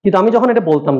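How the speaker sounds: usual speaking voice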